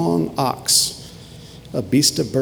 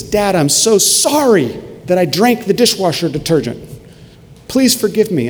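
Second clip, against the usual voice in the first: higher-pitched mock tearful plea, not screamed; pleading child; 6 semitones higher than usual. Small son begging dad's forgiveness after drinking detergent, mildly distraught. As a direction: lightly voice a hurting little boy apologizing. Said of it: "he's crying and screaming from the pain".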